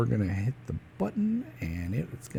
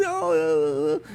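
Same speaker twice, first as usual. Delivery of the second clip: mock crying; slower than usual. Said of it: "he's crying".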